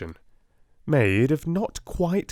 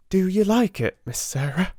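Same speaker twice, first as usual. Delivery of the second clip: shy maid Becky; 10 semitones higher than usual. A narrator's reading. Becky voiced by the narrator.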